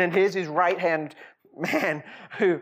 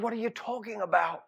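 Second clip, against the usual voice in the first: stunned king; 6 semitones higher, faster than usual. Acted. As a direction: blurt it out in disbelief. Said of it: "the king is stunned".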